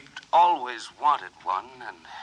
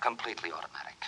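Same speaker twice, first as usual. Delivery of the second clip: much softer and lower, echoing numbly; dazed murmur; 8 semitones lower, 12 dB quieter, faster than usual. Acted. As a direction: repeat it faintly, lost in memory. Dazed patient echoes doctor.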